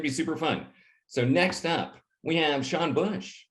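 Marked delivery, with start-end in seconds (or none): none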